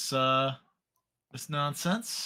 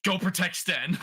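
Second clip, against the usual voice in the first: mock yell, raised pitch; higher, lightly yelled battle command; in-character yell; 7 semitones higher, 3 dB louder than usual. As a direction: call out an urgent order in character. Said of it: "As I'm yelling at Osric".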